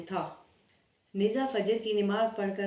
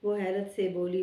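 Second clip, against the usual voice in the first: plain reading voice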